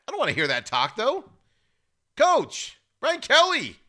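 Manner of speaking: high, loud exasperated protest; loud, high-pitched fan outrage; exasperated scolding. Real, not acted. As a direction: call out the coach, voice jumping way up. Scolding the absent coach.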